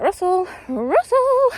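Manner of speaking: high, drawn-out call of the dog's name. Retold or imitated. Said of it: "I was like".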